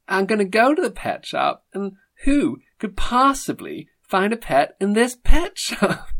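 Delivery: a squeaky take on a posh TV presenter; Lloyd Grossman impression. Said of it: "It was Lloyd Grossman".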